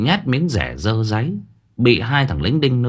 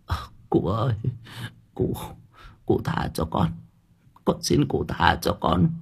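softer, barely voiced begging from a beaten old woman; faint feeble murmur; 3 semitones lower, 6 dB quieter than usual. A narrator's reading. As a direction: breathe the plea out with no strength left. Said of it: "Miệng bà thì chỉ biết thều thào".

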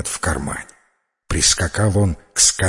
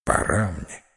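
low, soft parting words with long pauses; hushed farewell; 6 semitones lower, 6 dB quieter than usual. Acted. Old man bids Evan farewell, low and soft. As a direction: let the voice sink away as you take leave.

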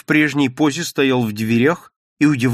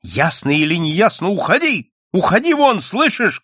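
high, loud shout; 7 semitones higher, 4 dB louder than usual. Acted. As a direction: yell at him to get out. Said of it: "крикнула Надя". (крик, высоко и громко)

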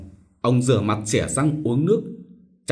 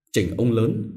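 usual speaking voice

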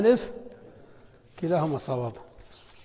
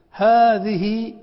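هذه stretched very slowly, higher and louder; drawn-out example word; 4 semitones higher, 7 dB louder, much slower than usual. Shown as a demonstration. Teacher showing the class the spelling, very drawn out. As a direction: lay out every sound of هذه. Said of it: "محذوف".

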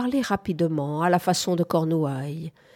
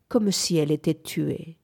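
ordinary delivery

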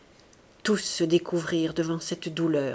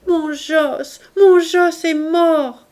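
high, slow, louder wail; grieving cry; 8 semitones higher, 8 dB louder, much slower than usual. A narrator's reading. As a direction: cry out the son's death again. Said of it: "Barbara Lamb cria de nouveau".

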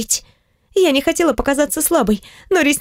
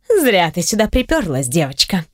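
put-on low, threatening girl's voice; menacing low bully voice; 6 semitones lower than usual. A narrator's reading. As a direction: sneer it down at her.

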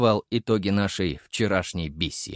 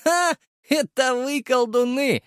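put-on high, drawn-out girlish jeer; mocking girl, pitched way up; scoffing young woman. A narrator's reading. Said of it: "усмехнулась молодая девица лет двадцати".

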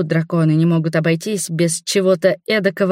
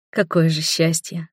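ordinary delivery, nothing marked

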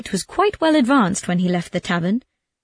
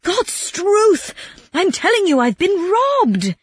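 very high-pitched acted yell; yelled protest; 11 semitones higher, 3 dB louder, slower than usual. A narrator's reading. Robbed man tells his friend the truth, yelling. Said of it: "really raised his voice and yelled".

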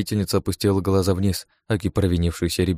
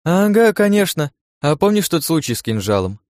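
mocking smirk; 10 semitones higher, 5 dB louder than usual. A narrator's reading. Irene reminds her companion of an old blunder, mocking. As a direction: needle her with sarcastic agreement. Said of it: "усмехнулась Ирен".

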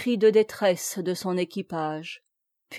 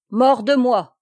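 loud, higher-pitched oath; exclaimed outburst; 4 semitones higher, 9 dB louder than usual. A narrator's reading. Guy de Plélan swearing at last before his rowers, exclaimed outburst. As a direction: cry it out as a sudden oath. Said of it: "s'écria enfin Guy de Plélan".

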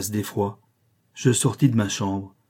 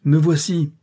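raised, higher call; calling out in answer; 6 semitones higher, 5 dB louder than usual. A narrator's reading. Rochester answers the searchers from upstairs, calling out. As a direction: answer loudly from a distance. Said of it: "répondit une voix".

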